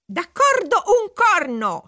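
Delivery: high, drawn-out indignant shriek; angry shriek. A narrator's reading. Princess rejects the cricket's proposal, shrieking angrily. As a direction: shriek your refusal, stretching the words. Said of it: "strillò arrabbiata la principessa Giulia".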